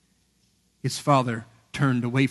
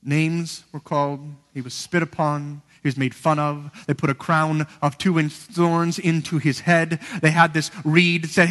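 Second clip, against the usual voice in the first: ordinary delivery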